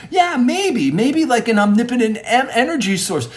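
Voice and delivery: high-pitched, eager thinking aloud; animated mock-earnest agreement; excited conceding. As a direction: act out warming to a friend's idea. Acted excited concession in debate.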